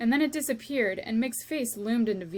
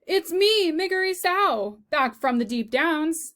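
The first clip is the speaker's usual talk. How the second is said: put-on high, loud country-girl shout; 6 semitones higher, 7 dB louder than usual. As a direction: call out gleefully, high and loud. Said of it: "she shouted".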